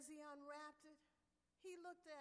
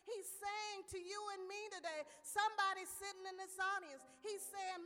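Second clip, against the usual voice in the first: louder, higher pulpit voice; preacher appealing to the audience, raised voice; preaching; 5 semitones higher, 7 dB louder than usual. Acted. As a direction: drive the message home to the congregation. Preacher delivering the message.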